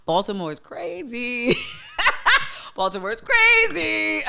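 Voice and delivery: high laughing Baltimore is crazy. Real, not acted. Telling viewers about Baltimore Pride, laughing high. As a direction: laugh through it, voice jumping high.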